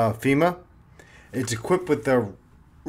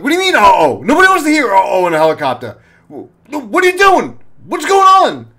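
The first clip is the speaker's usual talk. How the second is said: high, loud frantic shouting, climbing higher; panicked yelling; 8 semitones higher, 9 dB louder than usual. Retold or imitated. Cop yells at the pilot mid-emergency, loud and frantic. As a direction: yell in alarm over the rotor noise.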